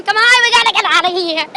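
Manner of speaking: real rising panic, rushed; panicked urging. Real, not acted. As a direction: urge everyone out in a panic. Panics after car moves.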